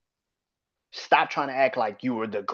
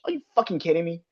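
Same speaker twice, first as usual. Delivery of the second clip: ordinary talk, nothing marked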